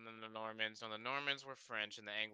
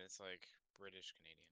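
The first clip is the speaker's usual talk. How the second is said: ordinary talk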